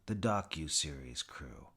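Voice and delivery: usual speaking voice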